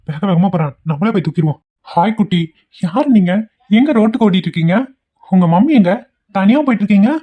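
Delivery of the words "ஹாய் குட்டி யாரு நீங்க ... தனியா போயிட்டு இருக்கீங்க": put-on high, sing-song child-directed questions; talking to a child. Man asks a lost child where mummy is, coaxingly. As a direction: coax a little one with lifted pitch.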